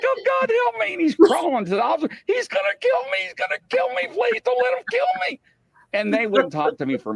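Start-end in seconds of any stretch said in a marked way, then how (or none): 0.0-0.9 very high, shrieking mock panic; terrified pleading. Panhandler begging the officer not to kill him, shrieking. 2.3-5.4 very high, shrieking mock panic; terrified pleading. Panhandler begging the officer not to kill him, shrieking.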